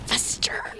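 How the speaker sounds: unvoiced whispered demand, slow and creepy; menacing whisper. Repeating the niece's line to friends, whispered menace. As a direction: hiss it like a spooky child. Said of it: "glares up and goes".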